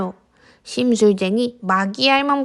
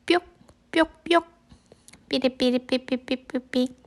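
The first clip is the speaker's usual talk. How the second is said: playful squeaks; 6 dB quieter than usual. Acted. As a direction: make silly squeaky sounds. Playful nonsense sounds.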